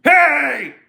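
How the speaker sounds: long, high, loud holler; imitated shout from behind. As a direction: mimic a man yelling after someone. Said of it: "all the fuck I heard from behind me was".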